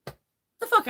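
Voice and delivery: a stunned, squeaky "what"; outraged disbelief. Retold or imitated. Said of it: "I go".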